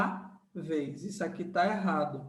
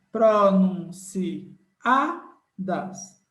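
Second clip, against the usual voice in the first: very slow, louder word split into syllables; 5 dB louder, much slower than usual. Shown as a demonstration. Teacher counting syllables for students, very slow. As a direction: count out each syllable slowly. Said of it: "Esse si separa do A".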